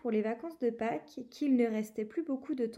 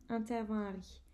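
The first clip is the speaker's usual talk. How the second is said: ordinary delivery, nothing marked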